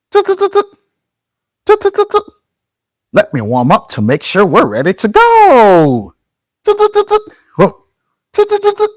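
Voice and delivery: high playful toot toot; squeaky toy-train toots; high toot noises. Pretend. Robot train noises for kids.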